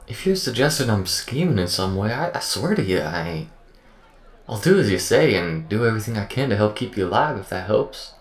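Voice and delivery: high, loud, swooping folksy voice; earnest AI character. Acted. Accused AI promises to keep the crew alive, loud and earnest. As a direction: protest innocence with big pitch swings. Acted AI character pleading its case.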